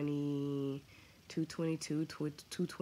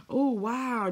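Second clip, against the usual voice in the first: surprised; 7 semitones higher, 7 dB louder than usual. Real, not acted. Surprised reading comment.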